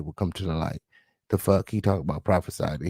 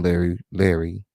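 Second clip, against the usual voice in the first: normal talking voice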